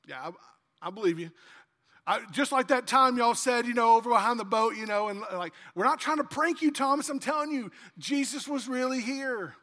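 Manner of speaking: hammed-up disbelief, then insistent friends; sarcastic Thomas. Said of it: "Thomas is like".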